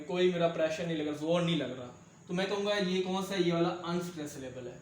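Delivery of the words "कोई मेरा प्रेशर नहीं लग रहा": ordinary talk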